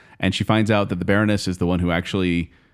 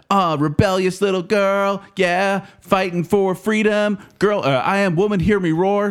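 much higher mock upbeat voice; 8 semitones higher than usual. Host mocks the movie's upbeat tone with a much higher voice. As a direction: parody the girl-power tone. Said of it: "it's like".

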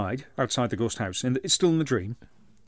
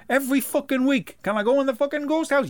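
put-on whiny child; 11 semitones higher than usual. Mother mimics nagging kid.

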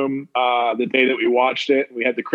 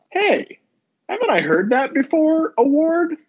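squeaky high put-on quote voice, playful; high mock award voice; 12 semitones higher than usual. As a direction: pitch it way up like a silly award title.

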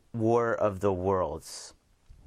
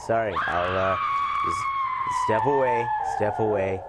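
raised voice, talking over someone; mock urgent placating. Host to a lady frightened by his looks, mock urgent placating. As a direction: play at calming an alarmed lady, urgently.

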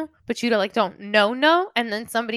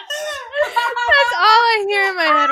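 helpless laughter; 8 semitones higher, slower than usual. Real, not acted. Friend finishing her goblin tease, crying with laughter. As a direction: wail it through a real laughing fit. Cracking up after own impression.

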